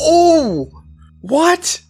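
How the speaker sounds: high startled cry; shocked. Real, not acted. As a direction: jolt up in surprise. Body ripped asunder.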